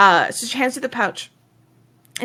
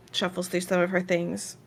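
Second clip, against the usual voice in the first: normal talking voice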